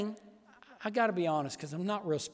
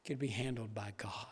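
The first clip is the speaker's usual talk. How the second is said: whisper; 7 semitones lower, 10 dB quieter than usual. Acted.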